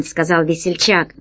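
plain reading voice

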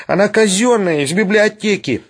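high-pitched, wailing protest; desperate plea. A narrator's reading. Sharikov begs for his library book, desperate. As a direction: plead high to save it. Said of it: "отчаянно воскликнул Шариков".

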